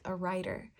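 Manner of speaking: ordinary talk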